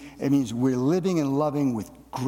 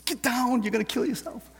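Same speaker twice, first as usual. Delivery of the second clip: put-on much higher, alarmed call to a climbing child; 10 semitones higher than usual. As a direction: yell up at the boy on the wall. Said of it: "I'm like".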